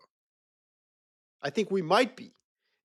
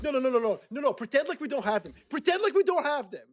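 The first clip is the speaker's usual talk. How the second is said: higher, fast, teasing refusal; mock cocky American; 9 semitones higher, faster than usual. American troops joking they won't use javelins, fast high mock refusal. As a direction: wave it off, too easy to bother.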